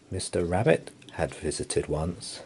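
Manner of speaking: ordinary delivery, nothing marked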